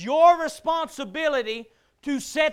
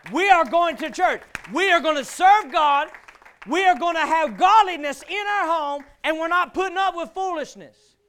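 loud, emphatic preaching as a resolute father; firm declaration; 3 semitones higher, 5 dB louder than usual. Acted. Acting father declaring church to his family, loud and firm. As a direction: lay down the household law, voice raised. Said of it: "It's your job to say".

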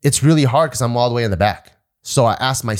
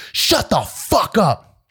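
slow drill instructor bark; 8 semitones higher, much slower than usual. Retold or imitated. Drill instructor silences recruit.

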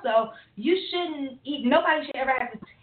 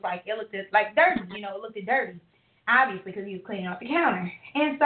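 ordinary talk

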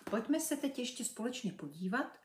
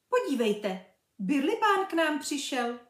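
high, loud delighted cry; excited girl; 6 semitones higher, 8 dB louder than usual. Acted. Little girl spotting Birlibán, excited. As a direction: clap and announce him gleefully. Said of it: "tleskla do dlaní".

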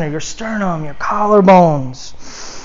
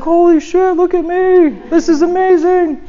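much higher, thrilled mock exclamation; imitating excited amazement; 10 semitones higher than usual. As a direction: play the football guy amazed at himself. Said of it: "He got all excited".

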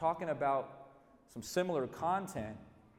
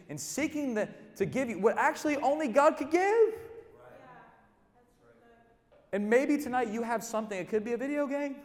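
loud, rising, slowed preaching; preaching at full force; 5 semitones higher, 3 dB louder than usual. Acted. Preacher urging the crowd to throw off weights, loud and high. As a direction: drive it home to the congregation.

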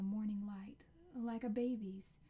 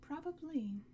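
said through a yawn; 6 semitones higher, slower than usual. A narrator's reading. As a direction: yawn the word out. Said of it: "his mom yawned".

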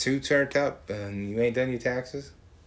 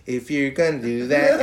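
mock scream; 2 semitones higher, 6 dB louder than usual. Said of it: "now he's screaming".

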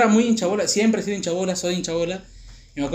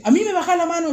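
high-pitched bossy teacher imitation; indignant teacher; 11 semitones higher, 4 dB louder than usual. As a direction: order it sharply, pitched way up. Teacher orders him, acted.

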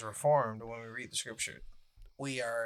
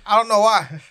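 higher, louder laughing repeat; 8 semitones higher, 13 dB louder than usual. Real, not acted. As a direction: laugh it out, amused by the hiccups. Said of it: "I got the hiccups".

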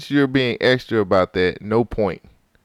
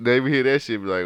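normal talking voice

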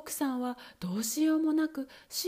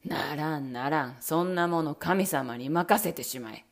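low, harsh refusal; Bluebeard refusing; 8 semitones lower, 4 dB louder than usual. Acted. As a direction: cut her off brutally. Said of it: "青ひげはこう言って剣を振り上げました".

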